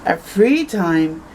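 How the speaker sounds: ordinary delivery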